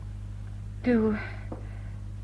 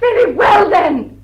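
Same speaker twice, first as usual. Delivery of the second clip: loud, higher, final; defiant outburst; 6 semitones higher, 7 dB louder, slower than usual. Acted. Fay defying Dilling as she rings the bell, loud. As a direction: snap it out as she acts.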